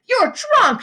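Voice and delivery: high, loud angry yell; furious high yell. A narrator's reading. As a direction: shout it at him in disgust. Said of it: "she yelled".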